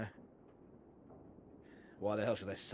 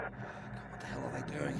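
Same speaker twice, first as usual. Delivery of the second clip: whispered worried aside; anxious breathless whisper; 8 semitones lower than usual. Acted. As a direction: hush it under the radio talk. Muttering aside during radio call.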